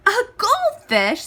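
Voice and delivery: high, loud disbelieving question; surprised parents. Acted. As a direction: react as if it's absurd.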